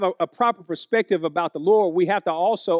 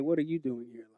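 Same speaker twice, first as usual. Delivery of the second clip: a hushed, much softer God asking the question; voice of God, whispered; 7 semitones lower, 12 dB quieter, much faster than usual. Acted. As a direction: lower it to a near whisper, as God speaking softly. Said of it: "That's where he was, in the whisper".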